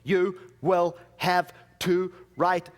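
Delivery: loud, slow, word-by-word frustration; frustrated. Retold or imitated. Frustrated card agent to a caller who keeps asking, exasperated. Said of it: "she got really frustrated and she said".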